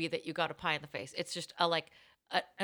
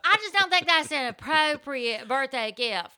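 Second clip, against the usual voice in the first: lightly voiced Southern mom complaining; a hint of a Southern accent, higher; 6 semitones higher, 5 dB louder than usual. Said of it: "Southern accent".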